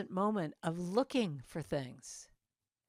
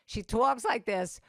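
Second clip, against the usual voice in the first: mock New York accent, louder and slower; Noreen; 3 semitones higher, 5 dB louder than usual. Showing how her New York friend talks, mock New York accent. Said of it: "I just love her accent".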